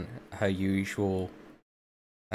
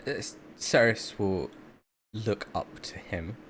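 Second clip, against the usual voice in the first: usual speaking voice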